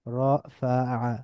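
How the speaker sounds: রাফাআ slowly as ro, fa, 'a; syllable by syllable. Shown as a demonstration. Teacher breaking down the word, রাফাআ syllable by syllable. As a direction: split রাফাআ into three drawn-out syllables. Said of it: "একটু মিলিয়ে মিলিয়ে পড়ব".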